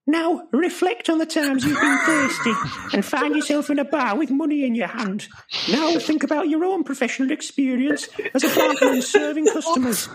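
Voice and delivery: high mock lecturer voice. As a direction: play a squeaky pompous educator. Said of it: "has he got laryngitis?".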